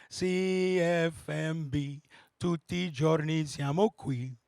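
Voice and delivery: low, very slow drawn-out radio announcer; low slow Italian radio ID; radio jingle. Retold or imitated. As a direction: croon the station ID like an old jingle.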